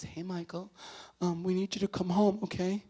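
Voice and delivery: high, fragile voice holding back crying; retold tearful plea on the phone; imitating tearful sister-in-law. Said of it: "she's on the line and she's crying".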